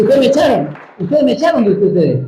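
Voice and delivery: mild mock indignation, pointed; indignant reproach. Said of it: "Y él dice". (reproche indignado, más agudo)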